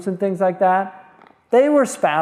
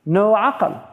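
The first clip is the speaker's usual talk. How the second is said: usual speaking voice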